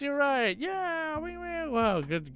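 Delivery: usual speaking voice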